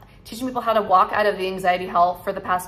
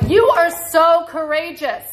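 higher and louder, an energetic burst of praise; bold cheer; 6 semitones higher, 6 dB louder than usual. Retold or imitated. Vlogger voicing the part of her that cheers viewers on, loud. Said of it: "part of me is like".

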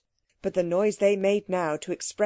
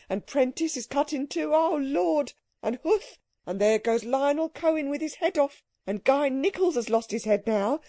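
higher, rising London-clerk voice in alarm; panicking clerk; 5 semitones higher than usual. Acted. Clerk watches the brokers being killed, high panicky outburst. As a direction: build from dismay to a high-pitched panic.

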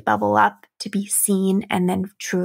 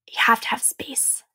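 breathy hushed whisper of the key point; 4 dB quieter than usual. Real, not acted. Coach to her listeners, whispering the key point. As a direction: drop to a whisper to land it.

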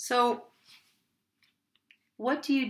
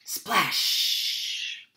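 usual speaking voice

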